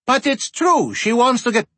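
put-on high, eager girl protest; insist, pitched high. A narrator's reading.

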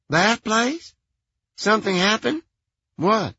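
airy, unbothered phone voice; Merrill's voice. A narrator's reading.

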